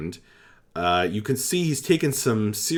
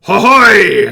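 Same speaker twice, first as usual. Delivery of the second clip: high, loud shouted cry; rally the troops with a shout; goblin captain's battle cry; 11 semitones higher, 15 dB louder than usual. Acted. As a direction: goblin captain leading the cheer. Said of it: "the other goblins call back".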